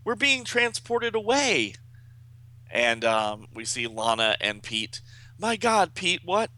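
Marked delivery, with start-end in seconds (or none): 0.0-1.8 high-pitched comic-book panic; dramatic high exclamation; alarmed cry. Acted. Acted alarm at being transported. 5.4-6.5 high, startled exclamation; acted horror, pitched up; shocked cry.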